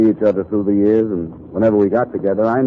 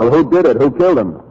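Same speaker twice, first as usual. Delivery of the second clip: loud, higher, furious questioning; angry demand; 7 semitones higher, 6 dB louder than usual. Acted. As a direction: shout him down for an answer. Demands to know friend's killer.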